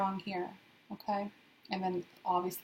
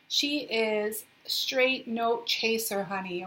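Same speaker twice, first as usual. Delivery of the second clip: ordinary talk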